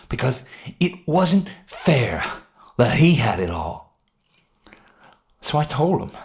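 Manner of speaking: a man confessing his guilt, tense and high; Henry's agitated confession. Acted.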